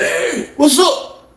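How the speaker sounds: high, very slow lazy greeting; slow high Chef. Retold or imitated. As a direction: drawl it out high.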